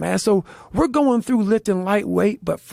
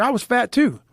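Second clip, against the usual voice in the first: ordinary delivery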